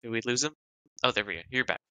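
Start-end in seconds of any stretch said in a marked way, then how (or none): none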